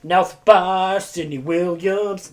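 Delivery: ordinary talk, nothing marked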